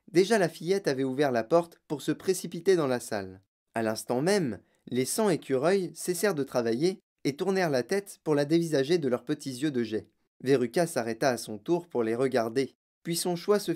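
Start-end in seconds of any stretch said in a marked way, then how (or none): none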